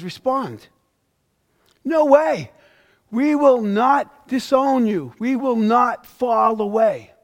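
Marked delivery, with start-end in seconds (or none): 1.8-7.1 louder, higher, slow insistent denial; no way, never, said loud and high; indignant protest. Acted. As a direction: protest like offended disciples swearing loyalty. Preacher voicing disciples' protest.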